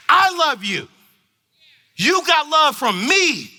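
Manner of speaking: loud, high, driving sermon voice; full-force preaching. Acted. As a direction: preach it hard to the parents.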